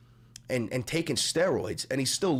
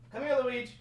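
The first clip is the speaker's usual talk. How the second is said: higher, sing-song calling; 11 semitones higher, 10 dB quieter, much faster than usual. Pretend. Streamer cooing for his dog Luigi to come in. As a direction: coo it out like calling a pet.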